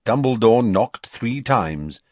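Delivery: usual speaking voice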